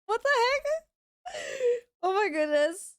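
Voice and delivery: high, slow, incredulous laugh-talk; laughing disbelief. Real, not acted. Streamer startled by a mystery alert, laughing disbelief. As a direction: squeak it out through laughter, baffled. Stunned by strange alert sound.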